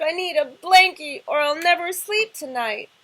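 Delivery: loud, high wailing sob; sobbing. Acted. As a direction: wail it through mock tears. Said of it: "He sobbed".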